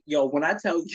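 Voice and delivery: ordinary talk, nothing marked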